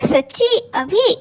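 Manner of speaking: light up with disbelieving joy; eager delight. Acted. Tara offered the kiss she begged for, excited and thrilled. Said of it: "तारा की आंखें चमक गई".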